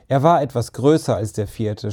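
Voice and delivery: ordinary delivery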